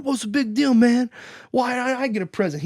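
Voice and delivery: high mock complaining kid; high-pitched protest, hammed up; bratty kid. Bully kid confronts postman.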